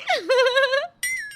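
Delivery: high-pitched happy giggle; high, tickled laugh; gleeful giggle. Acted. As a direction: let out a long, delighted giggle. Rita giggles after announcing visit.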